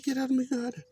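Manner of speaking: a high-pitched replay of his own shout; high mock voice. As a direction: squeak it out like a cartoon. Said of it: "I just said".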